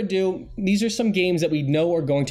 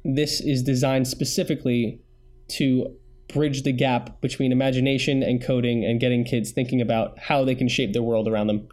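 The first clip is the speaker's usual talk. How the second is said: normal talking voice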